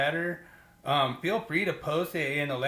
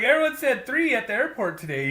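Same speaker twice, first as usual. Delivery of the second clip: teasing disbelief; 8 semitones higher than usual. Retold or imitated. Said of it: "I was like".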